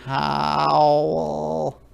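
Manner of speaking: long, drawn-out howl on the word; wolf cub howl. Acted. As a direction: howl like a protesting little wolf. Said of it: "And the wolf goes".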